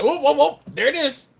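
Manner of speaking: high, loud mock catching-out; movie moment imitation. Teasing Quentin about country accent, high loud imitation. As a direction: pounce on it like a gotcha. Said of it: "I'm gonna be like".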